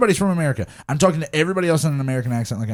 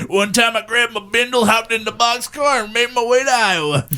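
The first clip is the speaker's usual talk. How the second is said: mock hobo yarn, pitched up and slowed on boxcar; lightly voiced rambling old drifter; old wino storyteller; 7 semitones higher than usual. Friends sharing a bottle, telling tall tales like a mild mock wino. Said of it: "like some old winos".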